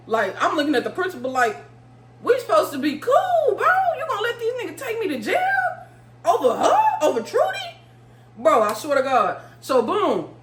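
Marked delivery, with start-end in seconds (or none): 2.2-7.8 very high-pitched mock protest; incredulous plea. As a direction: plead in disbelief, voice shooting way up. Retold incredulous plea to the principal.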